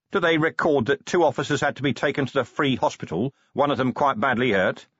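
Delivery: commander pressing a question. Acted. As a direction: press the butler with a touch of indignation. Voices Vimes in story.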